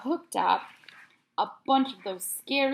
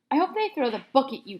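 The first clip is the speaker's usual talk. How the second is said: ordinary delivery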